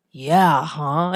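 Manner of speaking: put-on mildly sarcastic teenage retort; sarcastic, mocking. Teen answering advice about parents, sarcastic. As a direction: feign agreement with sarcasm. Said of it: "super sarcastic".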